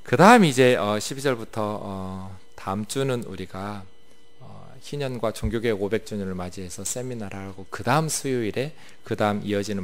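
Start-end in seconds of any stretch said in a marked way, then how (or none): none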